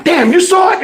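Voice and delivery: put-on thrilled kid bragging; hyped. Hyped kid after throw.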